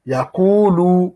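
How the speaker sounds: Yaqulu, le ou du milieu tenu deux temps, lentement; étire le ou dans Yaqulu, lentement et plus haut; long ou du med waw. Shown as a demonstration. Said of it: "Donc, le med waw". (Yaqulu lu lentement, ou bien prolongé)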